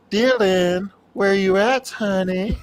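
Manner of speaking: high, teasing Freddy voice; Freddy voice demo. Shown as a demonstration. Showing a friend how Freddy should call Dylan, high and teasing. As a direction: coo like Freddy calling Dylan. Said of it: "Do go".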